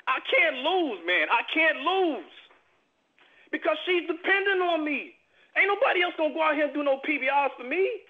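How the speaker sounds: rally them with urgent conviction; real, rising intensity from the heart; impassioned plea. Real, not acted. Impassioned plea about providing for daughter.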